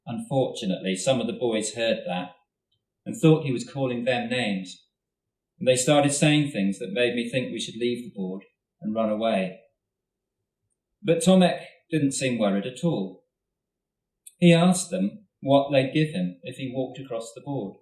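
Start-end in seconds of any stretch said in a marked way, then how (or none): none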